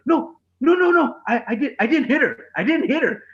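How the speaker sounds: high, hurried mock protest; panicked denial. Dad to staring shoppers in line, flustered denial.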